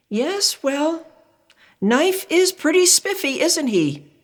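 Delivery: high, bright parent's agreeing reply; high cheerful parental reply. Acted. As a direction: humour the child like a parent. Parents voiced in story.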